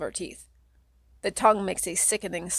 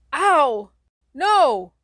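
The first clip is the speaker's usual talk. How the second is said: high, loud yelps of pain; pained cry; 7 semitones higher, 8 dB louder than usual. Acted. You crying out as the Rat Man bites, high and loud. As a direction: yelp in pain and protest.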